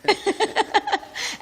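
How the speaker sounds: high, breaking burst of laughter. Real, not acted. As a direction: crack up at the joke. Laughter after retold answer.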